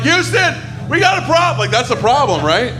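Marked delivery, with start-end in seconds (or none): 0.0-1.6 high, urgent Houston call; astronaut radio call. Retold or imitated. Itchy astronaut calling Houston, high and urgent. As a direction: call mission control in itchy panic. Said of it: "first astronaut going out there being like".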